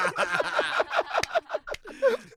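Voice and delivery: high laughing burst at the tweet; burst of laughter. Real, not acted. Co-host laughing hard at the absurd tweet, high burst. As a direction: laugh out loud, high and sharp. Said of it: "ダメだろ".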